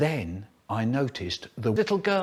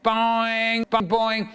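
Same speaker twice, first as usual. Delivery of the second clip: ringing, drawn-out bell noise; bell imitation; 8 semitones higher, much slower than usual. A narrator's reading. Narrator imitates the school bell.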